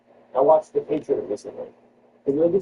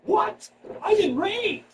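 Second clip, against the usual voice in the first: high-pitched mock indignation; mock outrage; 3 dB quieter, much slower than usual. Comic imagines heads reacting to the news, mock outraged. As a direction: act out outraged listeners in a high voice. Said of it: "I see heads going".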